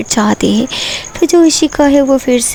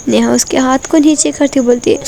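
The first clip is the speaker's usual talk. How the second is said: plain reading voice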